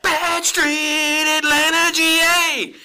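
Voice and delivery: high, slow announcer call; ring announcer. Pretend. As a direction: call the hometown out. Announcing Freebirds hometown.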